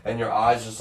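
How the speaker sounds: normal talking voice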